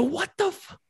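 high shocked what the f; high-pitched retold disbelief; shocked outburst. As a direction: blurt it out in disbelief. Retold shocked reaction to Henry.

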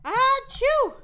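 a big high achoo; loud sneeze. Pretend. Prince allergic to the perfume, loud sneeze. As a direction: sneeze hard.

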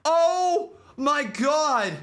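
normal talking voice

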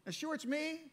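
doubting aloud, are you sure it's me; incredulous self-questioning. Retold or imitated. Preacher retelling his doubt to God, incredulous and loud. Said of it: "and you start going".

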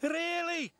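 high, mocking engine voice; a teasing, high-pitched question; chuckling James. A narrator's reading. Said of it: "chuckled James".

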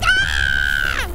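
loud sudden yell of shock; startled scream. Real, not acted. Player jumps at a sudden scare in the game, startled scream. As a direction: scream out in surprise.

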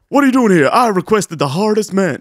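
high, loud protest; acted indignant demand. Playing Goggins confronting his visitor, mock indignant. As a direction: demand an answer, hammed-up outrage. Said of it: "He's like".

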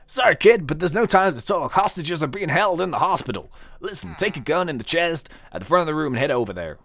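urgent cowboy, badly faked; terrible cowboy accent. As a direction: put on a hammy cowboy accent. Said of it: "my terrible cowboy accent".

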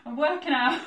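ordinary delivery

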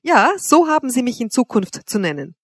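haughty, high-pitched correction; disdainful. A narrator's reading. Mistress dictating how to address her, scornfully. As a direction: put him in his place with disdain. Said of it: "mit unsäglicher Geringschätzung".

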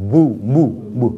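"mu" with the M of McDonald; English M sound. Shown as a demonstration. Teacher likens "mu" to McDonald's M, English M sound. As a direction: start "mu" on a humming M, then a long oo. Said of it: "就好像英文的McDonald的M的音啊".

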